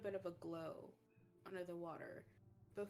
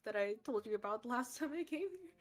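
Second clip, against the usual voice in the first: higher, louder voice of a proud mother; proud introduction; 5 semitones higher, 6 dB louder than usual. Acted. Mermaid introducing her child to Jerush, proud. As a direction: introduce your child in a high, lifted voice.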